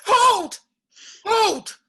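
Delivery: high, loud cry to stop them; shout. Acted. Woman stops the duel, shouting hold. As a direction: fling it out to halt the blades.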